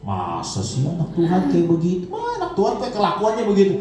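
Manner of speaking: lightly voiced sneering devil, fast at the end; mocking devil. Preacher voicing the devil mocking believers, light sneer. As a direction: tease like a scornful accuser. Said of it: "iblis yang bilang".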